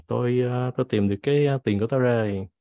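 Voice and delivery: usual speaking voice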